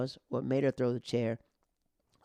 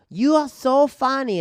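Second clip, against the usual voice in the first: high, loud, slow mock praise; aggressive heckling; 12 semitones higher, 11 dB louder, slower than usual. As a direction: yell the compliment like an attack, imitating a heckler. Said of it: "in an, an aggressive way".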